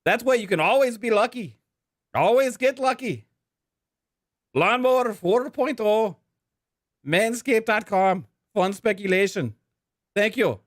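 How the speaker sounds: high-pitched, hammed-up Irish accent sponsor read. Podcast host reads the Saint Patrick's Day sponsor ad in a hammed-up Irish accent. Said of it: "we're gonna be reading this with an Irish accent".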